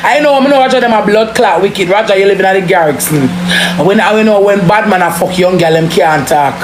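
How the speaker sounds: normal talking voice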